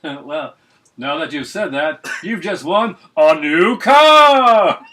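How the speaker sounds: booming host voice, slow and rising to a yell; game show host. Pretend. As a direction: announce the grand prize, huge and drawn out. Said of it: "He should have said".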